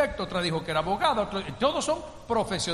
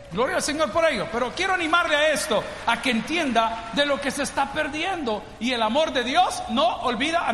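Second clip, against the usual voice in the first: louder, higher fervent preaching; preacher urging the congregation with fervor; full-force preaching; 5 semitones higher, 4 dB louder than usual. Real, not acted. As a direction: preach it out loud.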